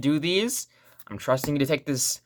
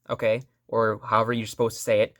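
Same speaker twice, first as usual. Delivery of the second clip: ordinary talk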